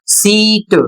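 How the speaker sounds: "सीट" slowly, with a long stretched ई; long ee pronunciation. Shown as a demonstration. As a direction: draw out the ee in "सीट". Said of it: "उच्चारण लंबा".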